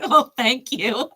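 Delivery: normal talking voice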